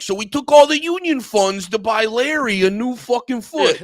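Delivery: high, loud, put-on mob voice; deadpan mob boss bragging; mock mobster confession. A mobster in a documentary, loud put-on voice. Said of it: "they're like".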